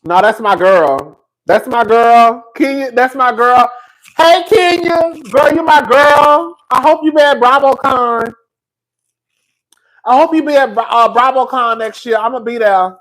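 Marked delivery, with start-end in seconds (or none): 0.0-11.8 loud and high, hyped; calling out fondly. Real, not acted. Fan calling out to Kenya, loud and high. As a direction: shout her love across the room.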